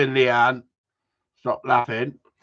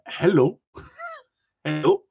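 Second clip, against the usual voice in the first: high put-on greeting breaking into a laugh; half-done impression of the doctor, giggling; a light send-up of a foreign doctor's hello; mock foreign doctor; 5 semitones higher than usual. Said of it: "I'm not gonna do an impression".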